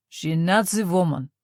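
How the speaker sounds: Russian officer in halting German, NAZI stressed; mangled German through a thick Russian accent; a Russian soldier sneering at the priest in broken German. A narrator's reading. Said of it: "he said in broken German".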